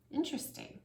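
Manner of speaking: breathy hushed word; soft mock wonder; whispered interesting. A narrator's reading. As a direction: breathe it like a secret. Man's line whispered.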